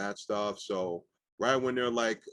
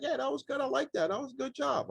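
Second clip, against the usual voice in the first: high-pitched celebrity impression, casual praise; Jay Leno; 14 semitones higher than usual. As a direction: mimic the host way up in pitch. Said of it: "Jay Leno, he's just like".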